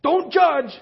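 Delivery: high, loud, indignant mock snap; imitating an angry family member; ticked-off retort. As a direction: fire it back like an offended relative. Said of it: "one of them got really ticked".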